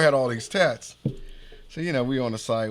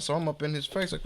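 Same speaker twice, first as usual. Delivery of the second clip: usual speaking voice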